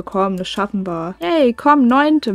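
ordinary delivery

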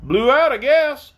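shouted. A narrator's reading. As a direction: shout it down to someone below. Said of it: "shouted Cap'n Bill".

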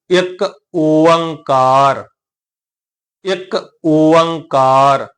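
ਇੱਕ ਓੰਕਾਰ very slow, ikk o-ang-kaar; short firm ਇੱਕ, then long held ਓੰਕਾਰ; pronunciation demo. Shown as a demonstration. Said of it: "ਇਕੱਠਾ ਉਚਾਰਨ ਕਰਾਂਗੇ". (ਇੱਕ ਓੰਕਾਰ ਹੌਲੀ, ਲਮਕਾ ਕੇ)

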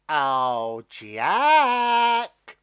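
loud, high, sing-song teasing; a mean stalk taunting Jack. Acted. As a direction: tease him from up high. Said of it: "it teased".

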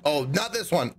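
ordinary talk

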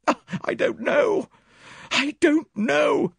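very high, loud distressed cry; torn, high-pitched helplessness; anguished. A narrator's reading. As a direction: wail it, slowing on the repeat. Pressed to choose a side.

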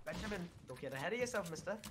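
put-on soft, teasing British accent; British-accented mock reprimand. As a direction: quietly tease him in a put-on British voice. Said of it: "Why are you doing a British accent".

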